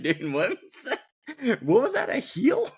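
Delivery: high-pitched mocking question; making fun. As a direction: tease him, pitch leaping up on the question. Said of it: "we were making fun of him".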